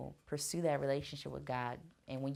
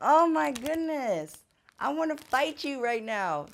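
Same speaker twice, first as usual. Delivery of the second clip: giddy disbelief; 11 semitones higher, 10 dB louder than usual. Real, not acted. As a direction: gush with giddy shock. Still reeling from the proposal.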